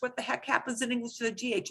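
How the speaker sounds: ordinary talk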